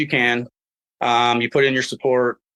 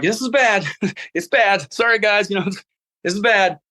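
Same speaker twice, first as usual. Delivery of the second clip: a higher, laughing this is bad; giggly; 7 semitones higher than usual. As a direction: laugh through this is bad while collapsing. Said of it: "I'm like".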